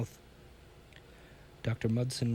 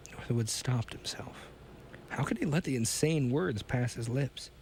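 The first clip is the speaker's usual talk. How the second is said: ordinary delivery, nothing marked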